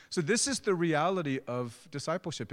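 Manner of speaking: ordinary delivery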